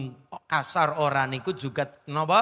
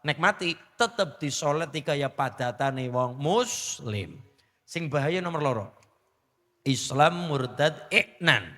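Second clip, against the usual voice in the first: ordinary delivery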